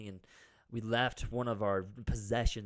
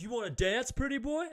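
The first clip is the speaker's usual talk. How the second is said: high, louder taunting tough-guy imitation; hammed-up macho challenge; mock tough guy; 13 semitones higher, 5 dB louder than usual. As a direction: sneer it like a cocky brawler. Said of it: "he gets all tough guy with me".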